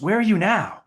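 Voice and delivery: high-voiced imitation; Walter Payton. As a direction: ask it in a put-on high voice. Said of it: "Walter had a high voice".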